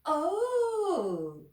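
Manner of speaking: high, loud held delight; guard beams at Mister Uppity. Acted. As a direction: sing out a long happy oh.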